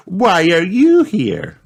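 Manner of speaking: put-on high, slow, childlike disbelief; astonished question. Peppa questions Mister Wolf at Granny's house, astonished. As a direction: ask slowly in high-pitched surprise. Shocked to find the wolf there.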